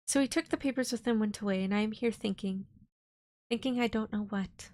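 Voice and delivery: steady storybook reading voice; story narration. A narrator's reading. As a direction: read it like an audiobook. Reading Dracula aloud.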